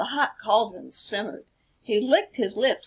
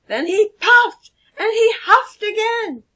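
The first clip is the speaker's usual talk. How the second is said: much higher and louder, straining; dramatic huffing; 12 semitones higher, 9 dB louder than usual. A narrator's reading. Narrator acts out the wolf's desperate huffing, high and loud. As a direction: blow it all out as hard as you can.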